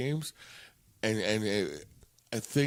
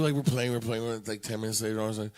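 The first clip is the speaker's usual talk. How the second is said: usual speaking voice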